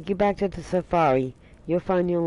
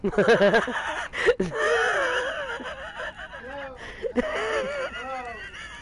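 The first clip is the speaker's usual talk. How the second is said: shrieking laughter. Real, not acted. Cracking up at own joke.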